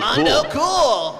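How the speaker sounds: high, loud, slow and laughing; excited shout. Acted. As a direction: belt it out with a laugh. Echoing the silly dub line.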